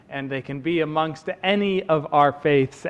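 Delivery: usual speaking voice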